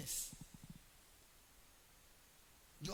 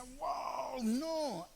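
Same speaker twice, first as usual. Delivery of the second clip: dismayed groan; 6 semitones higher, 4 dB louder than usual. Retold or imitated. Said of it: "Y yo decía".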